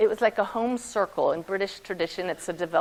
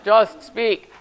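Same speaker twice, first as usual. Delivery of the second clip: firm command; 8 dB louder than usual. Retold or imitated. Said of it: "And he says".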